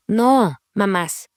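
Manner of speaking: normal talking voice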